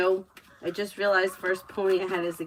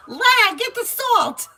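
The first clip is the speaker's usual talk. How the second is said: much higher and louder nagging shout; imitating a yell; 9 dB louder, slower than usual. Sketch mother shouting at her husband to salt, high yell. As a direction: bark orders at the husband across the house. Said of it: "she's yelling".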